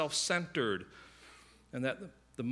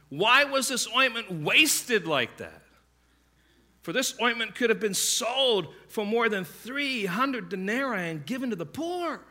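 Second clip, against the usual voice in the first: indignant; 7 semitones higher, 7 dB louder than usual. Retold or imitated. Disciples protesting the wasted ointment, indignant and louder. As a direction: voice the disciples' outrage, a little hammed-up. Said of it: "said to themselves indignantly".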